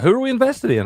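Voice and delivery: put-on very high kid voice; imitated young son. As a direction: pipe up like a boy asking Dad. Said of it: "then my oldest son".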